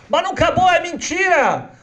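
put-on a girl insisting it's a lie; upset little girl. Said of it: "Essa menina vai pro Rebbe e fala". (menina indignada, voz aguda e alta)

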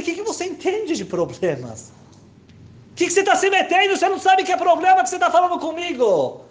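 high, loud indignant snap; mock indignant shout at the old man; angry retort. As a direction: throw it back at him, offended. Narrator snapping back at old man.

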